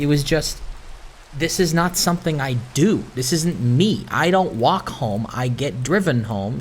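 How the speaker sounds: ordinary talk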